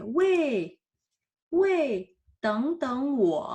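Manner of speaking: calling a friend, with wei in a sharp falling tone. Shown as a demonstration. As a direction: shout wei to stop a friend ahead. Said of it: "Donc, c'est".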